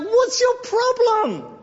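very high, drawn-out incredulous question; hammed-up high-pitched bafflement; mock exasperation. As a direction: squeal it slowly in comic disbelief. Exasperated reaction to friend's affected praying.

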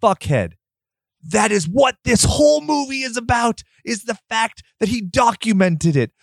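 fed-up shout at the movie's critics; exasperated retort. Retold or imitated. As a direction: yell it at them like they're idiots. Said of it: "And I was like".

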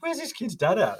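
wondering aloud in shock where the dad is; high outraged disbelief; incredulous. Retold or imitated. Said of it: "I was like".

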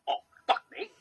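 high-pitched, badly done Australian accent; mock-alarmed local in a poor Australian accent; attempted Australian accent. Imitating locals who spot a magpie, attempted Australian accent. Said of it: "I can't do an Australian accent".

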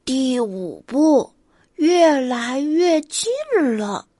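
lightly voiced rabbit reading; rabbit reading aloud. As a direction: read the rules like a serious little rabbit. Rabbit reads guidebook aloud.

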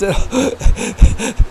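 a high, put-on laugh that sneers at the left; high mocking laugh. Host mocks the offended left with a sneering laugh. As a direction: laugh in a put-on voice to set up the mockery.

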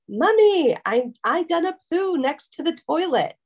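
put-on high little-kid voice; small toddler calling through the door; child voice. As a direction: play the three-year-old at the door. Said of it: "knocks on the door and goes".

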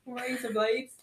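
"Razor blades" in playful Australian, rising end; mock Australian accent. Repeating her party-piece for friends, mock Australian accent.

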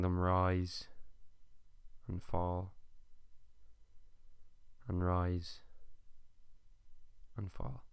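calm body-scan guidance with long pauses; guided meditation. Acted.

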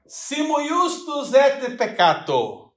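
slow, high chanted Latin like a priest; padre recitando latim. Shown as a demonstration. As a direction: intone the Latin slowly like a priest. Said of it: "Pareceu um padre agora". (entonação de padre em latim, lenta e aguda)

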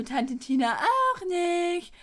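put-on drawn-out toddler refusal; whiny little kid. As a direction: mimic Tina pouting along with Bibi. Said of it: "Und Tina sagt dann so".

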